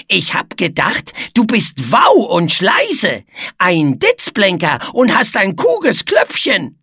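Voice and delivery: mischievous wordplay in a put-on voice; Etze, teasing trickster. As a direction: spell out the muddled insult with relish.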